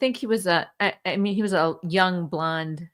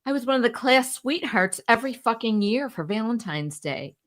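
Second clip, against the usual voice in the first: ordinary talk, nothing marked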